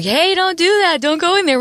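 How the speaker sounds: high-pitched, urgent mock shout; mock warning yell. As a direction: call out a warning, hammed up. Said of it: "like, yelling at us".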